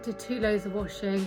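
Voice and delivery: ordinary talk, nothing marked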